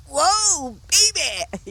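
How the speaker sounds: high, loud delighted whoa; thrilled whoop. Retold or imitated. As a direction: let it burst out like a kid let loose on a treat. Thrilled at being offered the car.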